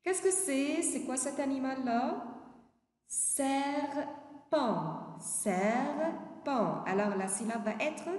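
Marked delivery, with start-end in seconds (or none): 3.1-6.8 serpent broken into two long syllables; syllable-by-syllable model. Shown as a demonstration. Teacher answers which animal it is, syllable by syllable. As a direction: draw serpent out, pausing between syllables.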